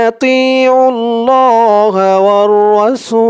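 slow, drawn-out chanted recitation; slow melodic Quranic chant. Acted. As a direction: intone it slowly like tilawat. Recites verse.

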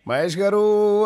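loud, high call for help; shouting for Mahesh from the chair. Retold or imitated. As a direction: yell the name out in panic. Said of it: "గట్టిగా అరిచాడు ఆయన".

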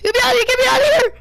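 high, panicked shout; trapped yelling. Real, not acted. Kid trapped by monster, panicked shout. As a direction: beg to be let out, loud.

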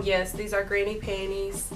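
ordinary talk, nothing marked

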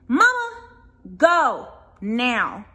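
loud, exasperated command; stern and fed up; firm order. Acted. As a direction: order her out, punching each word. Daughter orders arguing mother out.

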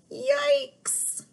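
frightened mouse. Acted. Teacher voicing the mouse spotting the cat, high squeak. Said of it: "The mouse says".

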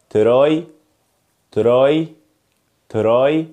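"تراي" with a hard t before a separate r; "تراي" with no sh sound between t and r; wrong TR pronunciation. Shown as a demonstration. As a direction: say "تراي" as "te-rai", no "ch" glide. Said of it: "يلا نبدأ مع اول حاجة وهي النطق الغلط".

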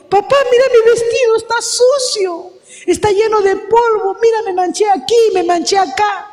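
put-on high, whining girl's voice; dismayed little girl. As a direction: sound like a child upset about her dress. Girl dismayed at dirty dress.

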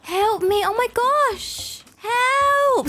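high-pitched hammed-up distress, calling for help; mock panic. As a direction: play it like a frantic pretend nurse in a crisis. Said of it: "Or we could just cry".

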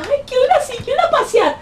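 high, whiny girly mock voice; mocking girlfriend. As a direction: parody a spoiled girlfriend begging. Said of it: "la morra se queda".

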